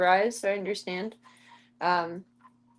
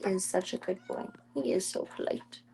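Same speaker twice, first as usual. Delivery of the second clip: soft whispered praise; 9 dB quieter than usual. Real, not acted. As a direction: whisper it to the cat. Cat owner cooing at pet.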